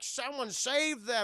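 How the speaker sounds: high, slow, drawn-out plea; mock alarm. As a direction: imitate a horrified onlooker. Townspeople alarmed.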